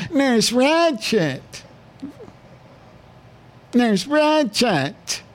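high, lightly voiced needy patient calling out; patient calling the nurse. Host playing a needy patient begging Vera, high whiny call. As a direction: whine up high for Nurse Ratched to bring the cigarettes.

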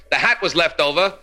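ordinary delivery

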